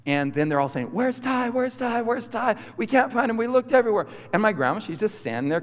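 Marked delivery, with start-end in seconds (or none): 0.9-4.1 put-on high-pitched kid voices; searching children. Cousins hunting for hidden boy, high childlike calling. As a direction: chant it high like puzzled children.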